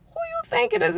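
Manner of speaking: squeaky high playful retort; high retold quip. Teasing her friend's husband at the door, high and playful. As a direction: toss it back in a high teasing voice.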